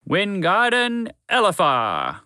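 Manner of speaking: slow, high, loud incantation; hammed-up spell casting. A narrator's reading. Horse to mouse, casting a fake spell, drawn-out and grand. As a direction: draw out the magic words like a grand wizard. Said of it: "waving the wand as much as he could".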